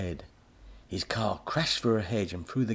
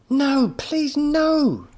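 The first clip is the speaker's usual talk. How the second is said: pleading cry; 10 dB louder, much slower than usual. A narrator's reading. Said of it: "Tommy cried".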